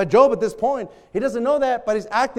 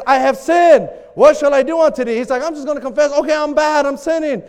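raised, louder mock confession; exasperated confession; 5 semitones higher, 6 dB louder than usual. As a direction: give in loudly, fed up. Acts out Job's exasperated confession.

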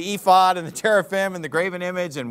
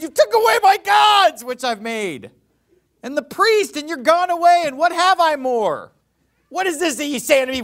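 much higher and louder, furious shout; angry outcry; 8 semitones higher, 5 dB louder than usual. Retold or imitated. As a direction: Micah yelling that his gods were stolen. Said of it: "and you're mad".

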